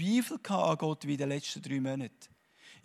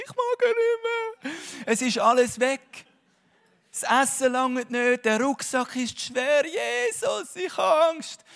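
high, louder whining complaint; mock whiny man; 7 semitones higher, 4 dB louder than usual. Exhausted man in Scotland whines to his wife on the phone. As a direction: play the worn-out hiker begging for help. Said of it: "der Frau oder der Freundin anrufen".

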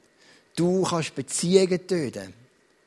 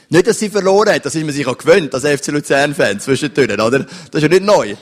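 loud preaching; 2 semitones lower, 10 dB louder than usual. Real, not acted. Preacher exclaims about the football match.

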